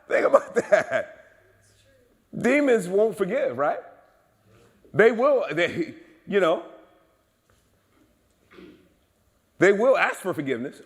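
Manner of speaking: high, loud pulpit delivery; impassioned preaching. Real, not acted. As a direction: punch it out to the congregation, pitched right up. Preaches at full force.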